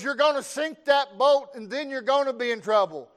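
high, loud scolding holler; grandpa yelling. Retold or imitated. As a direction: shout a warning out to the boat. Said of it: "he yells".

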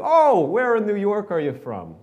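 high, loud imitated New York accent. Older women quizzing fellow New Yorkers, mimicked New York accent. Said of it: "a few older women, they were talking like this".

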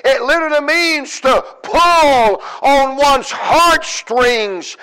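slow, drawn-out pulpit emphasis; emphatic preaching. Real, not acted. Preacher explaining how Jairus begged, slow emphatic preaching. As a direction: stretch each word for pleading, with pauses.

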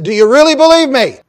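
loud, high booming question; loud probing question; God's voice. Acted. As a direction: press him hard: do you really. God asks again.

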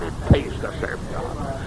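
soft, unvoiced echo; hushed repeat of the shouted line; whispered preaching. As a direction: whisper it right after the shout. Whispered take.

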